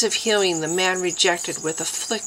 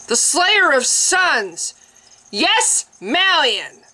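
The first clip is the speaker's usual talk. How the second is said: much higher and louder, slow, ecstatic; a zealot shrieking a holy name; fanatic cry; 9 semitones higher, 4 dB louder, slower than usual. A narrator's reading. Priest raving about the dark warder, high frenzied cry.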